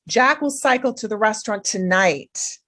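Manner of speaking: de-emphasize everything except a clear final "tonight"; linked, stress on TONIGHT. Shown as a demonstration. Teacher models number six, linking words and stressing "tonight". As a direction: run the words together, landing on "tonight". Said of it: "link them and I de-emphasize them".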